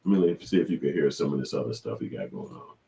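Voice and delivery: normal talking voice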